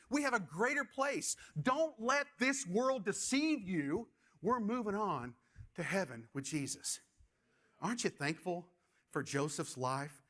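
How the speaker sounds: ordinary talk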